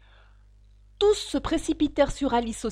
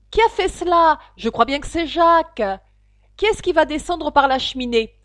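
voix d'animal agité; 5 semitones higher, 9 dB louder than usual. Acted. Animaux qui se disputent.

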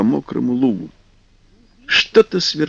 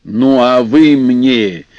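gruff voice; 4 dB louder, slower than usual. Acted. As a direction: snap back rudely like an old river spirit. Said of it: "грубым голосом ответил Водяной".

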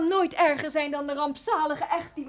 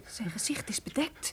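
hushed, unvoiced whisper; frightened whisper; 8 dB quieter than usual. Leonora whispers to the servants about the stranger, terrified. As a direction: breathe it so he can't hear.

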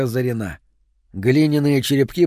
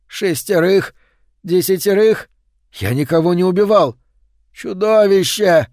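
louder, higher anguished protest; desperate shouting; 6 semitones higher, 4 dB louder than usual. Acted. Man accused of murder crying out, desperate. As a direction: cry out against the accusation.

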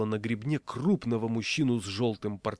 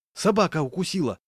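high, loud cry of alarm; 8 semitones higher, 5 dB louder than usual. A narrator's reading. Passenger spreading rumour of a bite, alarmed. Rumour shouted through the bus.

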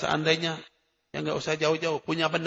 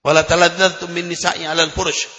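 raised, measured Arabic recitation of a hadith; 5 dB louder than usual. Acted. Preacher recites the hadith to the congregation, solemn and measured.

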